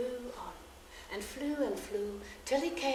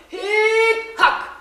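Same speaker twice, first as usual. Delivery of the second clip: loud hacking chant; 12 semitones higher, 14 dB louder than usual. Acted. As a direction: bang out the work rhythm. Millers hacking stone.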